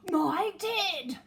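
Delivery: loud, high breathless cry; bear's shocked gasp. Acted. Bear finding its teddy, loud and startled. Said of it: "gasped the bear".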